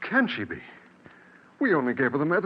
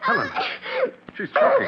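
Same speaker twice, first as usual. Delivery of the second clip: alarmed cry. Acted. Father cries out to wife as daughter chokes, panicked. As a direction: shout in terror as the child chokes.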